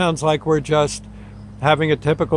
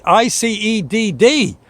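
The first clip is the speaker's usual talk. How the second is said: slow, higher, letter by letter; spelling it out slowly; 6 semitones higher, slower than usual. Retold or imitated. Dispatcher spelling the code slowly for the ambulance crew. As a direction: drag out each letter of I C E D D.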